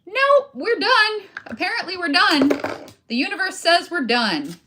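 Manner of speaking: loud, high, dramatic throwing in the towel; mock giving up. Crafter imitating quitting after inconveniences, mock defeat. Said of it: "the difference in attitude".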